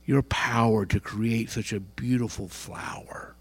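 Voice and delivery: slow, soft, near-whispered praise; whispered prayer. Pretend. The right way at a tulip, a whispered praise to God. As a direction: breathe it out to God in wonder.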